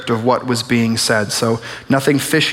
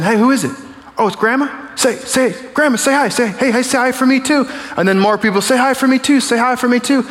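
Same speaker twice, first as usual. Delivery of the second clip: high, eager family voices calling to the phone; high eager shouts of say hi for me too; excited calling out; 10 semitones higher than usual. Retold or imitated. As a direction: call out like relatives crowding round the phone. Acted family calling out greetings.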